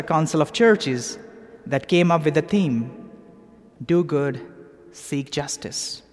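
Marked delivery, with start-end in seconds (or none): none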